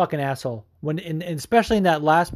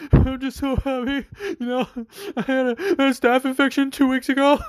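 high whiny mock voice, much higher; mocking Rockhold; 10 semitones higher than usual. Mocking the fighter's post-fight speech, high whiny mock voice. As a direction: mimic a fighter whining on the mic.

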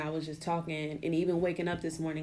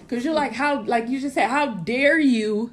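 tearful indignation; 6 semitones higher, 5 dB louder than usual. Real, not acted. Emotional, near tears, indignant.

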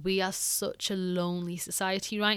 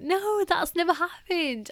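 high, slowing, moved disbelief; close to tears; 9 semitones higher, slower than usual. Retold or imitated. Volunteer overwhelmed by a thank-you gift, teary disbelief. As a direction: retell being touched, voice lifted and drawn out. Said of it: "getting emotional, like, teary-eyed".

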